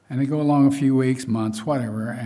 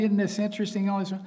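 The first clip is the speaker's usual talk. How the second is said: high-pitched mock fascination; eager new believer; 8 semitones higher, faster than usual. New believer starting Bible study, keenly interested and high. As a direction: play the keen beginner, pitched well up.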